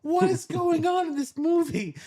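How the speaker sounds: mock-bewildered high wail; baffled stammer. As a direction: stutter out your confusion, almost crying. Narrator voicing his own baffled reaction.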